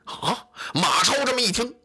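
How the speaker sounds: ordinary delivery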